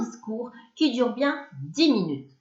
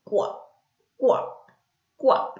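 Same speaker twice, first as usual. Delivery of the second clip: croaking frog; much slower than usual. A narrator's reading. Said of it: "en criant comme si elle avait le hoquet".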